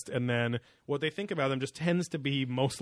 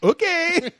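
put-on high squeaky French accent; french chef; 8 dB louder than usual. [name] answers.

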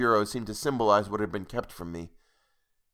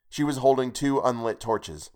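plain reading voice

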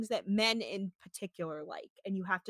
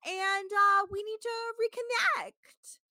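high, stagey diva impression; hammed-up theatrical reality star voice; New York theatrics voice; 12 semitones higher, 4 dB louder, slower than usual. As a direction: imitate her grandly, stretching words out up high. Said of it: "doing full on New York theatrics voice".

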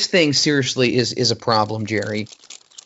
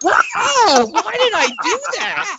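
cracking up, high and breaking with laughter; laughing; 3 dB louder, slower than usual. Real, not acted. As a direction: laugh through the words in disbelief. Pinching elbow, cracking up.

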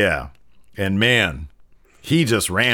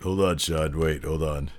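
low, soft acted phone aside; subdued, under-the-breath interruption; hushed aside; 7 semitones lower, 8 dB quieter than usual. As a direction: quietly put someone off to take a call. Acting out taking a phone call.

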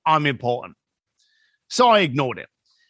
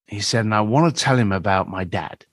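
ordinary delivery

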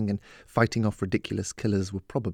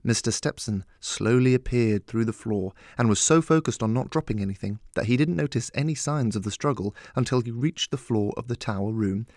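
ordinary delivery

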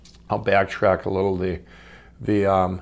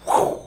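quick airy whoosh; whooshing "whew"; imitated whoosh. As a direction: blow a short rush of air, like something taking off. Imitating how fast sales took off.

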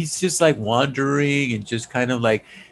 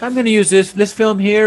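higher-pitched imitation of a filmmaker; lightly acted, higher-pitched filmmaker quote; imitating the director; 6 semitones higher, 4 dB louder than usual. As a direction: put on a higher voice for him. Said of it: "he just went like".

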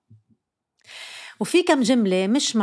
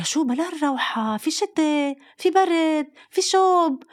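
a hint of nagging mother; 6 semitones higher, slower than usual. Mother objects to going out.

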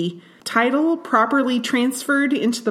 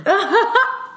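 high-pitched burst of laughter; high, quick giggling laugh; 11 semitones higher, 3 dB louder than usual. Real, not acted. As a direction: laugh out loud. Laughs at the DMV flaw.

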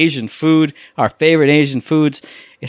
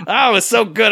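pitched-up delighted repeat; gleeful; 8 semitones higher than usual. Real, not acted. Gushing to his cohost about a gory kill, pitched-up delight. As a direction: savour the gore with a higher, delighted squeal.

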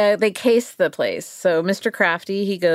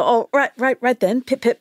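higher, hammed-up posh British accent; 5 semitones higher than usual. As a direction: play the jolly English toff. Mock posh Brit to police.

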